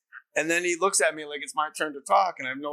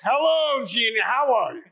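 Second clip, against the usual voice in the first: loud, much higher hammy greeting; Al Pacino; 10 semitones higher, 5 dB louder than usual. Retold or imitated. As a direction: boom it out as a hammed-up impression. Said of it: "Mom, this is Al".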